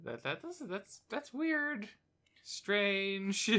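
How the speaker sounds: high, soft baffled disbelief. Retold or imitated. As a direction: squeak out confused disbelief. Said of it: "it's like".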